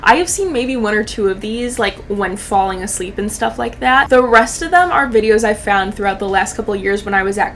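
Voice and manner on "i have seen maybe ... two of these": usual speaking voice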